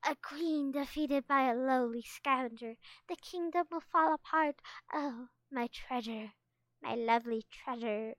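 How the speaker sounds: theatrical pretend despair, fading; howling defeated queen. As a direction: lament grandly, easing off toward the end. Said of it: "Sunny howled".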